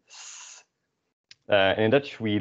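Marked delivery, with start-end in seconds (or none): 0.1-0.6 a hissed S with no voice; unvoiced S. Shown as a demonstration. Teacher showing an unvoiced S. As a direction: let the S hiss without voice. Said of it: "You don't feel anything here".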